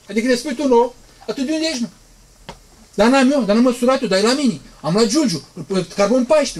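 lightly hammed-up scientist scoffing; mocking skeptic. Preacher imitates modern skeptics boasting of measurements, mild smug mockery.

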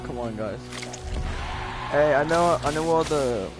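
ordinary delivery

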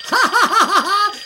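loud, triumphant laughing; real laughter. Real, not acted. Player celebrating surviving the final night, laughing. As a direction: burst out laughing in relief.

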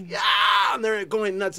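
ordinary talk, nothing marked

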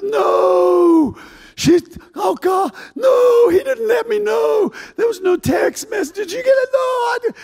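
frantic cry of alarm, pitch flying up; panicked shriek. Acted. Martha panics at surprise visit.

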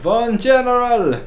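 put-on loud, slow German-accented reply. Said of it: "a teensy-weensy bit of an accent".

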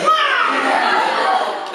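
loud, very high comic cry; sudden high-pitched yelp, put on; mock yelp. Dog gets a pencil under the tail, loud mock yelp. Imitates jab and dog's yelp.

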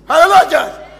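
loud, high hallelujah call; real pulpit exclamation; rousing preacher's shout. Real, not acted. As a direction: fire up the congregation. Preacher rouses congregation.

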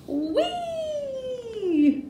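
long, high squeal sliding down at the end; play-acted joyride cheer; gleeful whee. A narrator's reading. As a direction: squeal it like riding a sled downhill. Sleigh flies down the hill.

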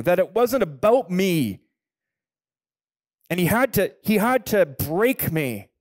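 high, loud preaching cry; loud pulpit testimony, voice pushed high; preaching at full force. Acted. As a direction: build the sermon to its peak.

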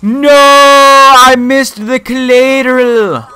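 slow, high wail of dismay; stretched-out gamer's cry of despair; drawn-out lament. Real, not acted. Wailing over missed kill.

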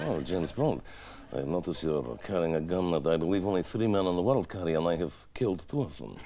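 put-on low, hushed Russian-accented menace; underplay the threat with a Russian accent; quiet, enigmatic villain. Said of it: "very quiet and enigmatic".

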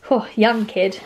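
ordinary delivery

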